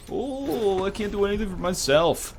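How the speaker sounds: high, whiny put-on voice; mock a helpless baby, high-pitched; teasing high imitation of helplessness; mock crybaby. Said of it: "Little baby bitch just cries".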